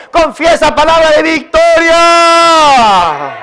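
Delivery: high, drawn-out rallying shout; triumphant preacher cry. Real, not acted. Preacher urging the congregation to confess victory, triumphant cry. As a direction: build to a shouted, stretched last word.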